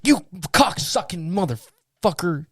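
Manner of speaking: high, drawn-out retold outburst of cursing; mock-enraged insult; pissed off. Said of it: "and I was pissed".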